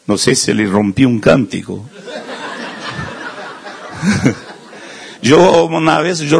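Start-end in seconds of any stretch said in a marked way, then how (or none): 2.1-4.3 a soft breathy laugh at himself. Real, not acted. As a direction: laugh quietly, almost voiceless, embarrassed. Laughs at breaking the song.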